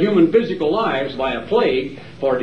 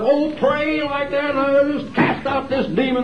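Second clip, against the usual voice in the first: lampoon a demon-casting TV preacher; mock ranting preacher; 8 semitones higher than usual. Preacher parodying a faith healer, high mock wail. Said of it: "ranting and raving like an absolute fool".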